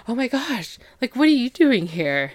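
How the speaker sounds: high-pitched, startled retold exclamation; mock surprise. Charlie finds her outside the bathroom, acted surprise. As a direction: act out being caught off guard, pitch jumping up. Startled at finding someone there.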